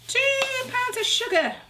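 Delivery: high, drawn-out announcement; playful announcing. Real, not acted. Cook announcing the sugar amount to viewers, playful announcing. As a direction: stretch it out slowly, pitched right up high.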